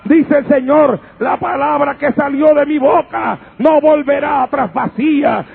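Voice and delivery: forceful scripture preaching, building in pitch and volume; impassioned Pentecostal proclamation; fervent preaching. Real, not acted. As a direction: declaim each phrase to the congregation, building to shouts.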